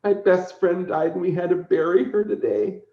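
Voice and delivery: lightly voiced sad little girl. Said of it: "She goes".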